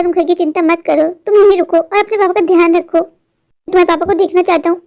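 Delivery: normal talking voice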